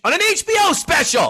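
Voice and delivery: high, loud outraged yell; hammed-up exasperated outburst; incredulous shout. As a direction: shout it in sheer disbelief. Mock outrage at the notebook.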